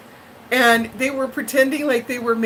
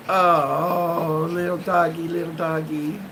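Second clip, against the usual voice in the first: cooing to a dog; 4 semitones lower than usual. Real, not acted. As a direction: coo at your little dog.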